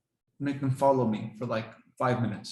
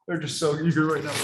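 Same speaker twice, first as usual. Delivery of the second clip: normal talking voice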